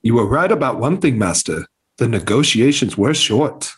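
put-on a light Scottish accent, dropping low on Master; Scottish-tinged Obi-Wan. Friend's Obi-Wan quote slips into a mild Scottish accent. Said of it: "I kinda fucking went Scottish there".

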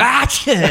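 sudden high sneeze sound; mock sneeze. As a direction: imitate a loud sneeze. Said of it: "I just hear".

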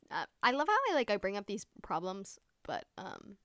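usual speaking voice

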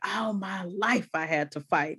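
a hint of The Color Purple character; The Color Purple quote. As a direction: quote it softly like a weary film heroine. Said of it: "you sound like The Color Purple".

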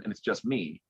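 ordinary talk, nothing marked

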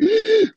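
sharp high gasp of disbelief; retold gasp. Musician retelling the call, sharp gasp. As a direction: gasp as if struck by the news.

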